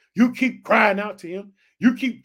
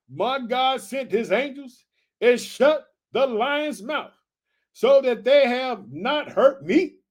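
slow, measured scripture words; solemn slow reading; 4 semitones higher than usual. Acted. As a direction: let each word land. Slow solemn reading of Daniel's reply.

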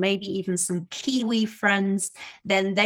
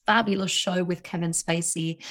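ordinary talk, nothing marked

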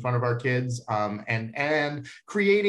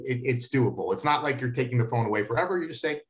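ordinary delivery

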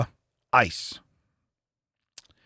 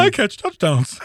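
bear voice; 6 semitones higher, slower than usual. Pretend. Continuing the bear voice.

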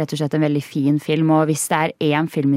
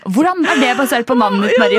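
higher and louder, protesting; indignant disbelief; 8 semitones higher, 6 dB louder, faster than usual. Real, not acted. Questioning the AI's odd name for her colleague, loud and high. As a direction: challenge it in amused disbelief.

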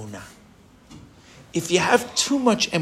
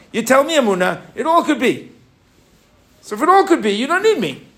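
eager arguing; 8 semitones higher, 4 dB louder than usual. Acted. Acting Moshe arguing with Hashem.

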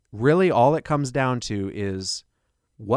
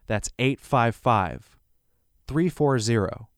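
slow, careful number repeat. Shown as a demonstration. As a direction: read each digit slowly. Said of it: "I'll say that a little slower".

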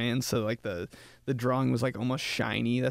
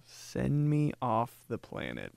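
mortified; 6 dB quieter, slower than usual. Retold or imitated. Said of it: "And I was like".

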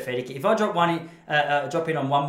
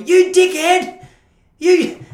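furious shout, high and loud; angry yell; 14 semitones higher, 11 dB louder, much slower than usual. Retold or imitated. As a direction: bellow it at a beginner. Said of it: "would hear behind me".

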